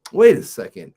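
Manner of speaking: loud, alarmed second take; the second realization hits harder. Retold or imitated. Realizing sneakers gone, retold.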